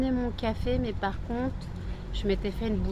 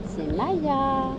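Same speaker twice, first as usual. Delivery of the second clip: high, louder cooing voice; pet talk; 6 semitones higher, 5 dB louder than usual. Real, not acted. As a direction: show off the puppy sweetly. Presenting her dog to viewers.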